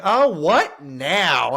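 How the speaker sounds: high, loud, very slow grandma voice; annoyed grandma. Retold or imitated. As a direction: drag out an exasperated old grandma line. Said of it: "She'd go".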